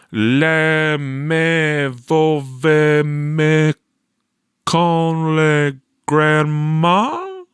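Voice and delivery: very slow, high, groping fake French; uncertain fake French. A narrator's reading. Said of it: "he said uncertainly".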